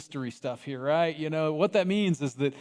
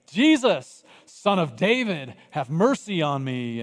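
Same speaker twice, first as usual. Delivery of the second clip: crying out again; 5 dB louder, slower than usual. Acted. Bartimaeus calling to Jesus again, loud plea. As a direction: call louder over the crowd. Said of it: "he doesn't care".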